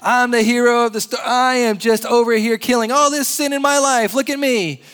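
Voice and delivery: hammed-up braggart hero crowing about himself; boastful hero. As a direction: puff up like a self-made hero showing off. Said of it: "you know, like".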